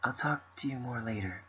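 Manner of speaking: quiet, gentle reading of game dialogue; soft character reading. Acted. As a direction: read the lines softly, in character. Reading visual novel dialogue.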